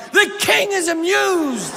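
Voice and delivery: high, slow, drawn-out proclamation; medieval courtier proclaiming. Retold or imitated. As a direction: herald it to the whole courtyard. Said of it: "like a moment in a medieval film".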